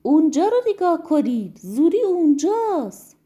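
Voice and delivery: big brother shouting he's found him; excited shout. Acted. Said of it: "فریاد زد".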